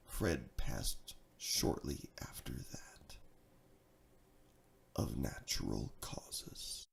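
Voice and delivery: slowing into a low whisper; dramatic whisper. A narrator's reading. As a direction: fade to a hush as if at a graveside. Dramatic death line in poem.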